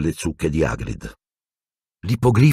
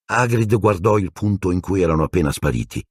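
usual speaking voice

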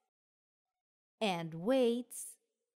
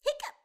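a sharp, much higher hiccup squeak; squeaky hiccup. A narrator's reading. Narrator voicing Pete's sudden high hiccup. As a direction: pop out a tiny high hiccup.

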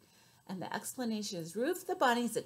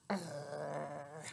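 low, soft growl; grumpy bear growling at the bunny; 7 semitones lower, 7 dB quieter than usual. Shown as a demonstration. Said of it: "Can you do a growl? A bear growl?".

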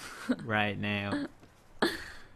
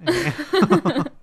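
laughing hang on; 12 dB louder than usual. Real, not acted. Laughing protest.

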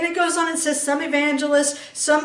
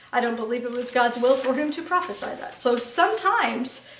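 ordinary talk

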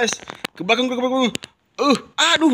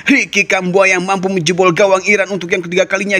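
normal talking voice

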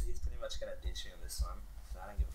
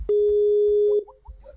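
loud yell; 14 dB louder than usual. Real, not acted. Hyped shout.

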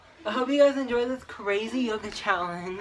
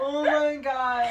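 laughing; 5 semitones higher, 4 dB louder, slower than usual. Real, not acted. Laughing at failed pose.

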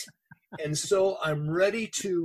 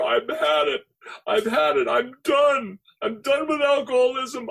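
higher and louder, crying out in release; weeping surrender; 7 semitones higher, 5 dB louder than usual. Retold or imitated. A broken man giving himself to God in the car, crying out. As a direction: pour it out through tears, begging. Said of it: "I started weeping profusely".

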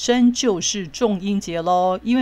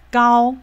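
把“高”念得又高又响; “高”字音调上扬、拖长强调; 示范高音; 3 semitones higher, 7 dB louder than usual. Shown as a demonstration. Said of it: "我们的发音应该是要高". (用高而响的声音强调“高”)

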